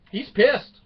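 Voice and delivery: high, loud mock kid voice; imitating his kids. His grown kids gossiping that he is angry, high and loud. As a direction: play the shocked children.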